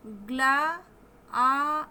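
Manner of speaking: "ग्ला, आ" in pieces, the aa held out; long aa. Shown as a demonstration. Teacher isolating the long vowel for learners, drawn out. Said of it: "थोड़ा लंबा खींच के बोलेंगे".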